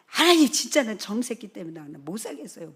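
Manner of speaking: loud, mock-exasperated complaint; retold exasperation. Pastor complaining to God about messy son, imitated exasperation. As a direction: imitate a fed-up parent complaining in prayer. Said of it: "너무 화가 나가지고서 막".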